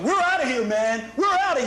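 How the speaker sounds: frantic high shout; panicked yelling. Retold or imitated. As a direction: yell it in a panic. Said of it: "He's going".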